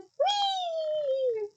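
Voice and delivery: high, gleeful squeal; gleeful whee. Acted. Peeves squealing with glee at the students. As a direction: squeal with glee as you throw.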